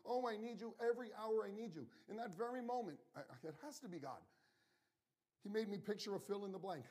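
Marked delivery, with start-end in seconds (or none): 0.1-1.9 raised, higher voice crying out to God; a retold cry of need, higher and louder; cried out. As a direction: call out the prayer with urgency. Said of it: "as I cried out to him".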